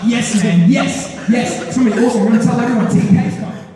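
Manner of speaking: hammed-up celebration of good news; excited friend. Friend thrilled that he will come.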